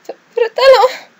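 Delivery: very high, loud, slow stammered call; anguished high wail of fratello; desperate cry. Acted. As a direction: call out to the brother one last time. Character's final cry to brother.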